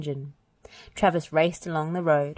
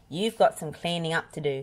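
plain reading voice

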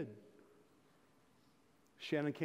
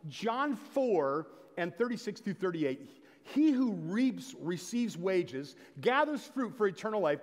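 louder, raised sermon delivery; fervent preaching; 4 semitones higher, 6 dB louder than usual. Acted. Pastor urging his church to pray, fervent preaching. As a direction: drive the points home to the pews.

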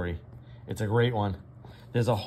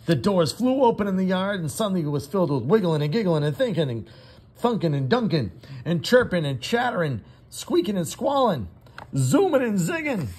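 higher, louder, faster rush; excited burst; 9 semitones higher, 6 dB louder than usual. A narrator's reading. Teacher narrating noisy recess to her class, excited. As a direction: let the recess chaos tumble out.

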